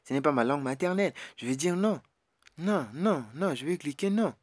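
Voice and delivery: normal talking voice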